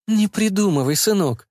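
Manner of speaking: mother's high, brushing-off tone; dismissive. A narrator's reading. Mother brushing aside her son's excuse, dismissive. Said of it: "отмахнулась мама".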